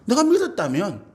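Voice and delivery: usual speaking voice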